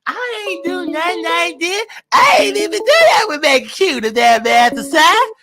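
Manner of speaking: high-pitched mock victim whine; playing the victim. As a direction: put on a high, wronged-victim voice. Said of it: "She gonna play victim".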